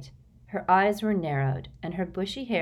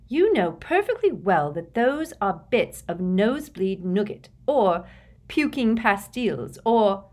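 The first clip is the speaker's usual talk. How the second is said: scolding; 4 semitones higher, 5 dB louder than usual. A narrator's reading. Hermione scolding.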